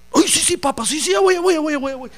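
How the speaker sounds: put-on panicked kid voice; frantic child. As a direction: scramble to obey. Child scared of father's belt.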